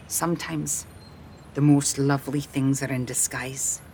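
low, soft male character voice; hushed, understated compliment; gently pointed. A narrator's reading. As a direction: offer it quietly, with meaning.

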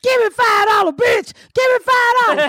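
high-pitched pestering yell; mock begging holler. Woman begging at the car window, mock begging holler.

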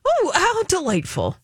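dry mock enthusiasm; sarcastic. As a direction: sound thrilled but mean the opposite. Sarcastic about Big Brother.

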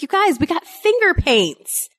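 put-on high, excited mom to the kids; excited announcement. Mom announcing finger paints to her kids, excited. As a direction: sell the treat with rising glee.

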